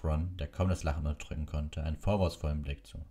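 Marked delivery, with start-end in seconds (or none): none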